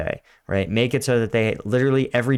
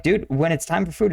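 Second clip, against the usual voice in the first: usual speaking voice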